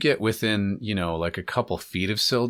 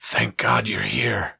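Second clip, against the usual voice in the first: relieved captive; slower than usual. Acted. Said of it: "and it's like".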